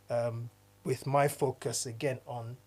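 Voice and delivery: normal talking voice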